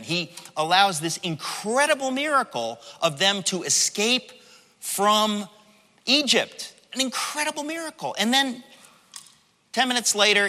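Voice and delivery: ordinary talk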